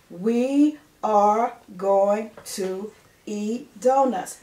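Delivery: very slow, each word drawn out; slow read-aloud. Shown as a demonstration. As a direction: read it slowly for the class. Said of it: "Let's say the words again".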